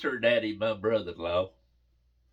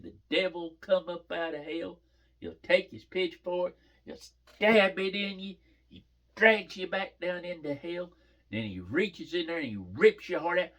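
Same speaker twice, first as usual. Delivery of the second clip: higher, hammed-up kid storyteller, verbs leaned on; child's scary tale; 7 semitones higher than usual. Daughter warns Papa what the devil does to liars, hammed-up scary tale. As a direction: act out a child's gory warning with relish.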